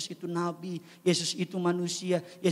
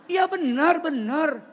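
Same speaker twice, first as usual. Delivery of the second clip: emphatic agreement; 7 semitones higher, 6 dB louder, slower than usual. Real, not acted. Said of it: "self-aware exclamation".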